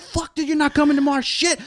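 high-pitched, hammed-up warning; mock threatening dog.

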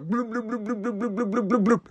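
high, quiet bloop-bloop chugging sounds; gulping noises. Retold or imitated. Mimicking last-call crowd gulping drinks. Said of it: "everybody's like".

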